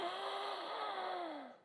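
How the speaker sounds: sharp, high astonished gasp; big gasp. Retold or imitated. Third grader hearing his name from the teacher, high gasp. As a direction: gasp in starstruck shock. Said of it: "And I'm like".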